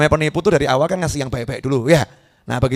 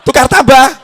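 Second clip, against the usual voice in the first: shouted joke; 9 semitones higher, 8 dB louder than usual. Real, not acted. Jamaah berteriak jawab.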